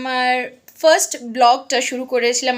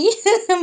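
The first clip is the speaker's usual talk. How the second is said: short high giggle; brief amused laugh mid-introduction; 8 semitones higher than usual. Real, not acted. Giggles while introducing her mother. (ছোট্ট খিলখিল হাসি)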